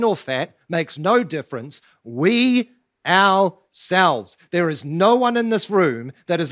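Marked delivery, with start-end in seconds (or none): 2.0-4.4 "We" stretched very slow and loud, "ourselves" falling; drawn-out emphasis. Shown as a demonstration. Preacher insisting nobody is excluded, very slow loud "We ourselves". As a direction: hold "We" long and loud before "ourselves". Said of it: "It's emphatic".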